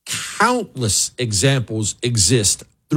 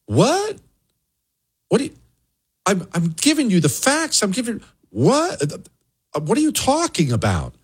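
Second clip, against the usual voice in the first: baffled disbelief; 7 semitones higher than usual. Retold or imitated. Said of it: "looking at them like".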